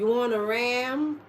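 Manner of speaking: slow, mock Texan "You want a ram?"; Texan accent. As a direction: drawl it like a Texas cowboy. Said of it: "Like a Texas Rangers".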